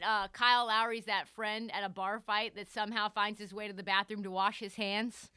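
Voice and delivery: ordinary delivery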